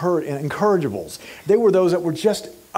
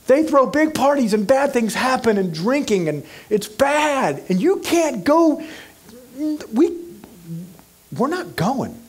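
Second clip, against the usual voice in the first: mock pleading disciple; 6 semitones higher than usual. Disciples protest to Jesus.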